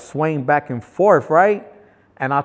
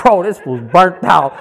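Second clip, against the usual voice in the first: laughing while saying it, louder; 4 semitones higher, 5 dB louder than usual. Retold or imitated. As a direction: crack up mocking the guy. Everyone laughing at him.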